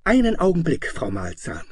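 put-on high, loud boyish call; bright angry boy's voice. A narrator's reading. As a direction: call out sharply as an indignant boy. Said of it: "helle, zornige Jungenstimme rief".

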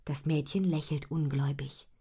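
ordinary delivery, nothing marked

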